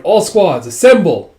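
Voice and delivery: high, loud rallying call; acted military command. Officer calls all squads to assemble, loud command. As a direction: call the troops to order. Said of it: "you hear Judo going".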